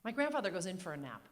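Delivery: ordinary talk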